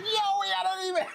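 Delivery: very high, breaking with excitement; excited squeal. Retold or imitated. Host replaying his voice message to cohost, squealing excitement. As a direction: squeak the news out giddy. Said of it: "I did a voice message".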